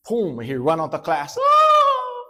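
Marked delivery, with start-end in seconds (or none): 1.4-2.2 very high, loud hammed-up scream; comic high shriek of pain; mock scream. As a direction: yelp like you were hit.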